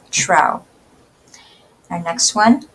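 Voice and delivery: ordinary talk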